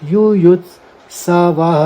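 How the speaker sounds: युयुत्सवः very slow, ending in ह; युयुत्सवः with its final ह sounded; visarga sounded. Shown as a demonstration. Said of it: "वह पढ़ा ही जाएगा ये".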